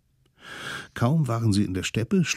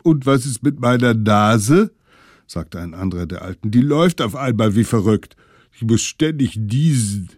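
klagender Alter; 3 semitones higher, 6 dB louder than usual. Acted. Erkälteter Alter klagt.

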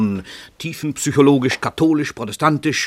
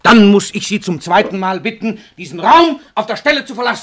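much higher and louder, throwing him out; angry order; 8 semitones higher, 9 dB louder than usual. Acted. Writer ordering the detective to leave at once, angry. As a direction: bark him out of the room.

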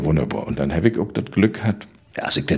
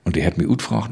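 usual speaking voice

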